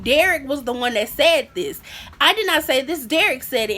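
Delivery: ordinary talk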